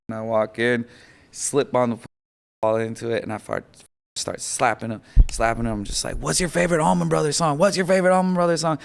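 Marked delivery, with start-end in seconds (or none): 6.2-8.8 urgent calling. Retold or imitated. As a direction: shake him awake with the question, faster the second time. Slapping dad to wake him.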